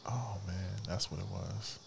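whisper. Real, not acted. Sudden random thought aside.

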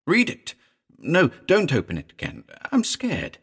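high, panicky back-and-forth; flustered and frightened; scared. A narrator's reading. Frightened friend flip-flops.

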